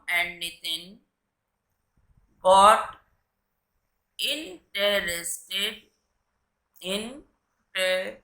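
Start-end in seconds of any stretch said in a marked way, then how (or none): 4.2-5.7 slow dictation. Shown as a demonstration. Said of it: "slow repeat for class". 6.8-8.2 slow dictation. Shown as a demonstration. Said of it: "slow repeat for class".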